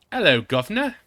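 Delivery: high, loud mock British greeting; hammed-up British accent, chirpy. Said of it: "I got to do my British accent".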